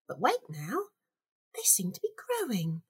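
low, soft, quick aside; puzzled murmur to herself; hushed puzzlement. Acted. Gloves mysteriously change.

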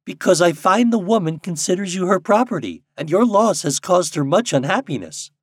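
high, loud character voice; Nuke explaining. A narrator's reading. Popopo answering the blue jay, in earnest argument. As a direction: argue the case as the Nuke. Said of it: "answered Popopo".

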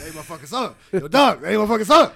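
loud, hoarse [name] bark; angry [name] voice. Retold or imitated. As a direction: shout it like a furious rapper. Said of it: "And it's like".

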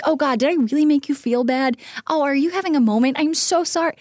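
high, loud, overdone mock sorrow; hammed-up apology. Mocking an apologizer who makes it about themselves, hammed-up. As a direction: play the apologizer as a drama queen.

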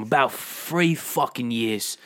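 slow put-on Cockney; drawn-out British tough guy answer; put-on London hard man; Jason Statham. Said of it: "Well, as Jason Statham".

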